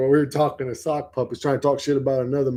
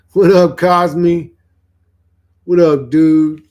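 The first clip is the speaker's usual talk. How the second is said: loud, slow shout-out to a viewer; calling out a greeting; 4 semitones higher, 9 dB louder, slower than usual. Real, not acted. Greeting a chat viewer by name.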